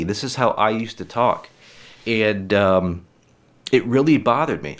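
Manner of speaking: usual speaking voice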